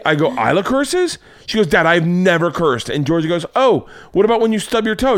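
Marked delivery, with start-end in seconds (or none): 0.3-1.2 high, rising mock disbelief; shocked question. Dad reacting to daughter's tattling, mock-shocked. 1.7-2.9 higher, slow mock protest; earnest denial. Accused daughter protesting to dad, indignant. 3.6-5.2 high-pitched mock challenge; accusing sister. Daughter challenging sister's denial, accusing.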